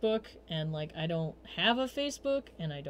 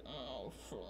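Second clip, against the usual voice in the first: hungover groan; 7 semitones lower, 17 dB quieter than usual. Retold or imitated.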